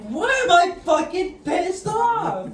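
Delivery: high, slow, full-voiced retold yell; angry yell. Smoker suddenly angry in front of family, yelling. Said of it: "I yelled it out".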